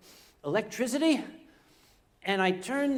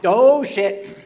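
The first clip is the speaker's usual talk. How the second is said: normal talking voice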